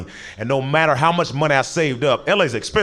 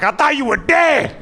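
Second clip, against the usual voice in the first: dog voiced high and slow, overjoyed; 12 semitones higher than usual. Dog greets owner back from ten minutes, high and drawn out. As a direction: squeak it out like a relieved puppy.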